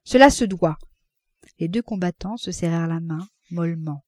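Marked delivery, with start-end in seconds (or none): 0.0-0.8 ordre sec, plus fort et plus aigu; impérieux. A narrator's reading. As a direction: trancher avec autorité. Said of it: "avec un geste impérieux". (ton impérieux qui clôt l'affaire)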